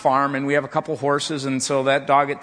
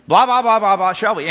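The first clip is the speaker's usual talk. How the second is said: louder, higher yelling at the dog; mock yell at the dog; 6 semitones higher, 7 dB louder than usual. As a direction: bark the nonsense at the dog. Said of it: "I would kinda yell at it".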